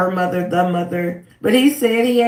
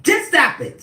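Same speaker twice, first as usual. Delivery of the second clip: higher, sharp command; hyped-up judge; 7 semitones higher than usual. Retold or imitated. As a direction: snap it off first. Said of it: "the judge is still hyped up".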